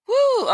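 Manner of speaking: high excited woo; shaking off tension, woo; woo exclaimed. Real, not acted. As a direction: let out a big woo. Exclaiming release.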